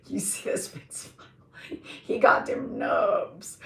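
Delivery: breathy giggled words; wheezing with laughter; laughing. Real, not acted. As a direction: laugh through the line. Laughing through repeated joke.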